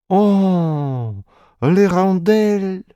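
high, slow delighted exclamation; exclaiming. Acted. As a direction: cry out in delight. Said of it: "воскликнул Молар".

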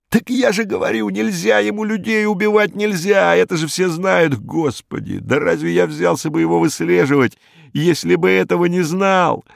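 a scared, scornful little thug blustering; Hinkus voiced. A narrator's reading. Narrator voicing Hinkus to the inspector, a high, jittery crook voice.

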